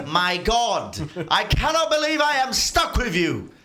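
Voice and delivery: loud, high, fed-up voice; exasperated head. Acted. GM voices the smart head despairing of its twin. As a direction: groan it like a long-suffering partner.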